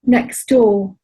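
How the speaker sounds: slow poetry voice, spaced phrases; poem reading. Acted. Poet reading a poem about their elders, slow measured recital. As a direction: set each image down slowly between pauses. Reads the next poem aloud.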